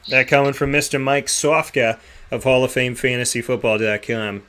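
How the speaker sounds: put-on announcer line in a thick Boston accent. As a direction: drop the r's Boston style, like a radio plug. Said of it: "I said it like that with my Boston accent".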